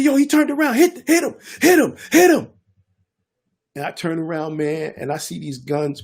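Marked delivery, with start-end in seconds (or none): 0.0-2.5 urgent shouting. Retold or imitated. Gunmen urging each other to shoot, high yells. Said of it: "And they said".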